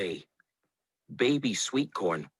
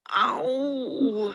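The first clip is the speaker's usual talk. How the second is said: long, high "ow"; drawn-out high moan of pain; 13 semitones higher than usual. A narrator's reading. Tomato, knocked flat by Pepper, moaning. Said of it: "moaned Tomato".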